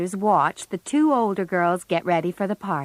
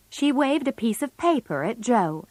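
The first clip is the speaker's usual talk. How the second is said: plain reading voice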